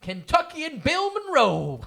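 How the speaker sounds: excited. Pretend. Hamming up the names.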